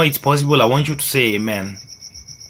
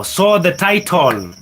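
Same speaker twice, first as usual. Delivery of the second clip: ordinary delivery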